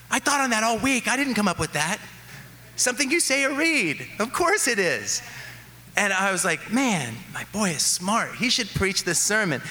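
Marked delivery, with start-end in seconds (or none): 0.1-5.2 excited amazement. Retold or imitated. Dad's excited reaction retold. 6.7-9.6 higher, lightly voiced proud father; proud delight. Proud dad's inner thought.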